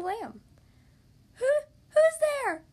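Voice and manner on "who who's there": high, loud, drawn-out piggy call; little pig calling. A narrator's reading. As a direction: call out to the door in a squeaky pig voice. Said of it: "called the second little pig".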